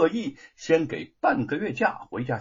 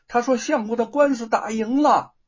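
higher and louder, bursting with the good news; loud excited news; 9 semitones higher, 6 dB louder than usual. A narrator's reading. Wife shouting the lawsuit news to her mother-in-law. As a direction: call out that the case is won. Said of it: "大声的告诉婆婆".

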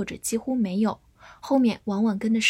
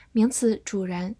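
ordinary talk, nothing marked

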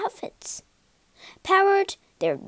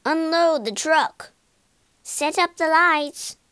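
raised, louder orders; acted shout, higher and louder; 5 semitones higher, 5 dB louder than usual. A narrator's reading. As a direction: call out orders across a courtyard. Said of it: "he shouted".

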